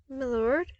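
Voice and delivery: plain reading voice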